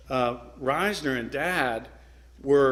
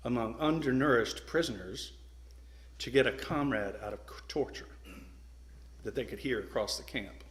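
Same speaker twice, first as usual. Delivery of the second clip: measured phrases with long pauses, trailing softer; slow and deliberate; 5 dB quieter than usual. Real, not acted. Speaker spells out what hunger strikes cost prisoners, slow and deliberate. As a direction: weigh each phrase before the next. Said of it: "Let me say this slowly".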